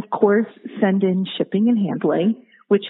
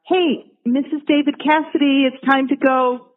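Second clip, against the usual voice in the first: imitated mom's call, pitched up; 5 semitones higher than usual. Mom telling her daughter it's time to go, imitated calling. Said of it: "she would have to call".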